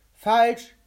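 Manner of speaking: high, very loud single-word cry; triumphant shout. A narrator's reading. The mouth rejects the captain's attempt, shouting triumphantly. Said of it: "schrie der Mund triumphierend".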